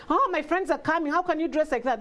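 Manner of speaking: high mock scolding daughter; disapproving daughter. Daughter scolding mother's outfit before friends, high and disapproving. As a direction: scold mom like a fussy teen.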